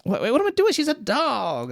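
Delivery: high, startled self-correction; comic panicked realization; mock alarm. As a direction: catch yourself in sudden horror, played up. Character catches himself in alarm.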